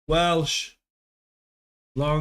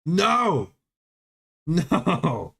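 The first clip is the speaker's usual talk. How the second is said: mock anguished cry. Real, not acted. Reacting in mock despair.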